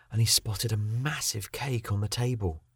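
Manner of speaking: ordinary delivery, nothing marked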